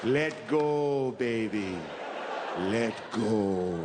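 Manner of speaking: low, slow, hushed reassurance; soothing deathbed comfort. Acted. Woman at his deathbed soothing him, low and slow. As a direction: ease him gently into dying. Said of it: "a woman looking at you going".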